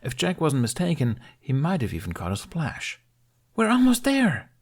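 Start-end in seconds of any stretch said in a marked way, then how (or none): 3.5-4.5 put-on high, loud excited child cheer; a boy's excited shout. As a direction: cheer it out, thrilled to be close. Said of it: "Jamie cheered".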